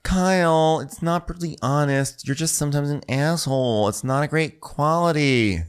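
high-pitched mock reproach; high voice, telling someone off; acted scolding. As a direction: scold in a high, exasperated put-on voice. Acted quarrel with Kyle.

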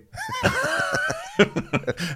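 high shriek of laughter. Real, not acted. Laughing hard.

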